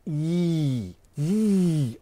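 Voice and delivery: long, drawn-out "ee"; ee vowel demo. Shown as a demonstration. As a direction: hold each "ee" as a pure vowel. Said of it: "you have to say".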